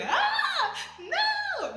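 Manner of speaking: high, loud squeal of "no"; mock siren scream. Fan screaming at two in the morning, high squeal. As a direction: shriek like a fangirl siren. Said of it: "I'm screaming. I'm a siren".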